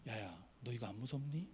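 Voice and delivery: low, soft, caring question; very kindly. Retold or imitated. Pastor asking small boy in the queue, tenderly and softly. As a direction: ask gently, like a kind grown-up to a child. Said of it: "엄청 자상하게 제가".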